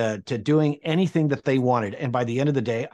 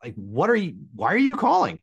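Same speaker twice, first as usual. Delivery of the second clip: squeaky high disbelief, climbing up; stunned; 7 semitones higher than usual. Retold or imitated. Young intern stunned as the DJ phones him. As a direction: gasp it out, starstruck.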